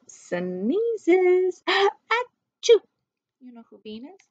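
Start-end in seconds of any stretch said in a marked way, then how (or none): 1.7-2.8 high, loud playful sneeze; mock sneeze. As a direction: pop out a cartoon sneeze. Puppy sneezes.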